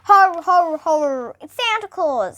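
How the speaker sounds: lightly voiced jolly Santa; a hint of a chuckle as Santa; mildly jolly Father Christmas; chuckling Santa. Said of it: "said Santa, chuckling".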